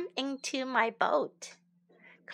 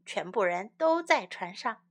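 ordinary talk, nothing marked

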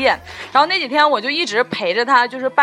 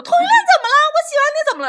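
very high, wailing, a hammed-up sob; mock crying plea; 4 dB louder, much faster than usual. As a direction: whine it like crouching and weeping. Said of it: "然后他就蹲地上哭".

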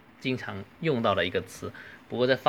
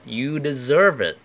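higher, louder "well earned" delivery; approving praise; 4 semitones higher, 5 dB louder than usual. Acted. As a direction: praise someone who studied hard. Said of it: "你是应得的". (称赞对方应得，语调上扬)

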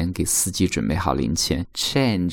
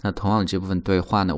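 ordinary talk, nothing marked

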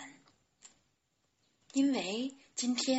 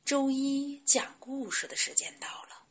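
plain reading voice

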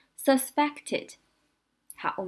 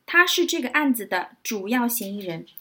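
normal talking voice

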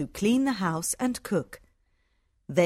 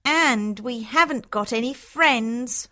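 sulky Hortensia; 3 semitones higher than usual. A narrator's reading. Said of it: "says Hortensia".

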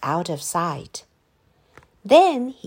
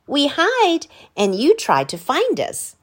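put-on a boy's voice, a bit higher; explaining Danny; 7 semitones higher, 4 dB louder than usual. A narrator's reading. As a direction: explain the game simply. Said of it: "said Danny".

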